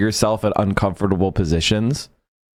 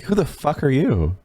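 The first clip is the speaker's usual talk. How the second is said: higher-pitched mock outrage, a hint of the imam; lightly voiced imam defending his mayor; playful imitation, rising higher as it goes; imitating the indignant imam; 10 semitones higher than usual. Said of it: "It's the imam, you know, being like".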